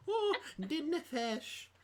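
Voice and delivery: high, drawn-out mock Scottish cry; mocking Scottish accent. Making fun of Melora, hammed-up mock Scottish. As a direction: make fun in a silly, stretched Scottish brogue. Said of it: "make fun of Melora".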